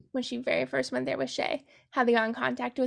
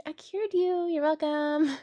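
sassy; 4 semitones higher, slower than usual. Retold or imitated. Tally sassing Doctor Cable.

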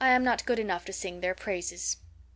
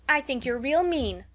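higher, louder petulant call; calling after; 5 semitones higher, 4 dB louder than usual. A narrator's reading. Lily calling after the departing girls, petulant. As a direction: fling it after them down the stairs. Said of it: "called Lily after them".

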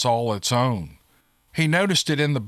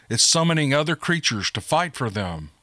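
ordinary delivery

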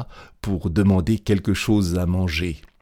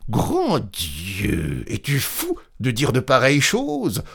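rising, high-pitched character voice; apothicaire effaré; 6 semitones higher than usual. A narrator's reading. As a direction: exclaim aghast, climbing higher. Apothecary appalled by confession. (voix effarée qui monte)

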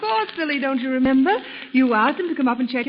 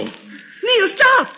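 scream; 10 semitones higher, 5 dB louder than usual. Acted. Wife screams as he heads to railing.

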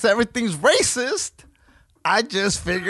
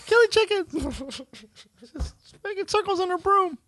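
put-on very high squeaky child voice; little girls' voices; 12 semitones higher than usual. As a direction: call her name like excited little girls. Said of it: "That's how second graders talk".

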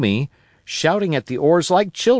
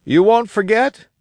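high, louder anxious plea; 9 semitones higher, 5 dB louder than usual. Acted. Castaway anxiously begging Jim not to forget, high and louder. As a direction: press him, worried he'll forget. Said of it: "he inquired anxiously".

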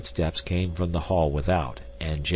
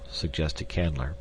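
plain reading voice

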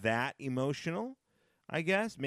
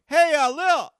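high, loud call to his daughter; 14 dB louder than usual. Acted. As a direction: act out hollering for a child in the house. Acts out calling to his daughter.

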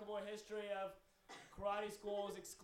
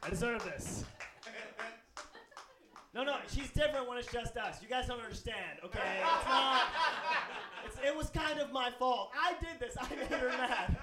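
mock defensive plea; 5 semitones higher, 7 dB louder than usual. Mock defending abusive partner.

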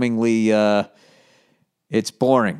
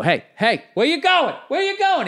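playful chase call. Retold or imitated. Dad chasing his toddler down the hall, high and playful. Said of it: "I just go".

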